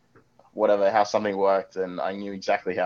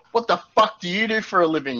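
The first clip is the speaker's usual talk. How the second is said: raised, high-pitched angry demand; abusive outburst; 8 semitones higher than usual. Acted. Tech worker losing it at the farmer, angry outburst. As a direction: snap at the customer, pitched way up. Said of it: "at the top of my lungs".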